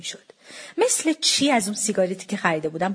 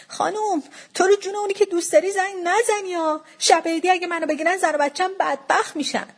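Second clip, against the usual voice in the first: put-on high-pitched, imploring young man; pleading; 7 semitones higher than usual. A narrator's reading. Seller begging her not to call police, pleading. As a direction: beg for mercy in a lamenting tone. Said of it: "لابه کنون گفت".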